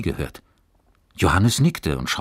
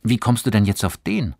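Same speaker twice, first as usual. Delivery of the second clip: higher, louder, wondering question; surprised boy, pitch up; puzzled; 6 semitones higher, 6 dB louder than usual. A narrator's reading. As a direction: ask it quite taken aback. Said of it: "sagte Johannes verwundert".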